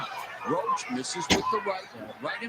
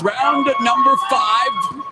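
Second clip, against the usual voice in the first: louder, even slower boxing announcer bellow; big ring announcer call; 4 semitones higher, 8 dB louder, slower than usual. Pretend. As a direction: stretch out every word like a ring announcer. Bigger announcer call over music.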